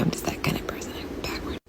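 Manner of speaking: soft secret whisper; hushed self-mocking aside. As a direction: breathe it like a confession. Whispered aside after repeat.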